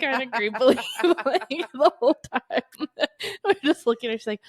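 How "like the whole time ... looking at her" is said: laughing. Real, not acted. Laughing at mom's face.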